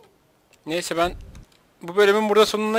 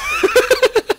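loud, squealing high laugh; 6 dB louder than usual. Real, not acted. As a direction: burst out in shrieking laughter. Patlamaya gülüyor. (çok tiz, yüksek kahkaha)

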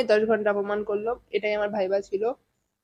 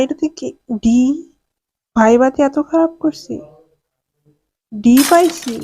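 louder, raised disbelief at the grade; dismayed surprise; 3 semitones higher, 4 dB louder than usual. Real, not acted. Student to viewers seeing a D in viva, louder dismay. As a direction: cry out at the bad mark.

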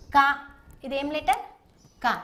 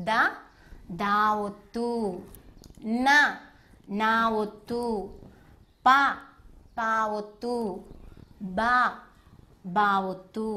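slow drill recital; 3 semitones lower, slower than usual. Shown as a demonstration. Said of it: "నాతో పాటు మీరు కూడా చెప్పండి".

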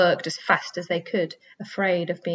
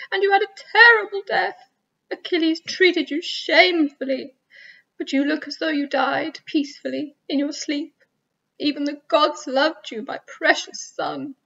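keen over his body, pitched up; grieving mother; 9 semitones higher than usual. A narrator's reading. Hecuba mourns her son.

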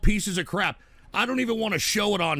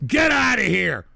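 angry shout; 3 semitones higher, 6 dB louder than usual. Real, not acted. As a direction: yell it loud and harsh. Angry rant at chat.

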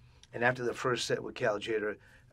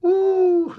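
high, loud, drawn-out imitated "ooh"; mock-awed falsetto whoop; impressed "ooh"; 15 dB louder than usual. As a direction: hoot it like an impressed dad. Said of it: "like that".

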